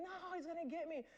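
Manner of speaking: high, frightened cry of no; screaming in fear of the cowboy; terrified scream. Retold or imitated. Hallucinating in the hospital, screaming in terror. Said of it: "I'm screaming".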